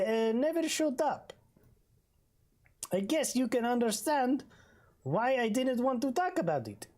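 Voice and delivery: high put-on accent, hesitant; Bull's accent. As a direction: fumble it out in Bull's squeaky voice. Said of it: "and he finally said".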